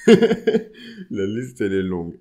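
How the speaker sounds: slow, loud words through laughter; laughing. Real, not acted. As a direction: laugh it out while insisting. Laughing repeat of the boast.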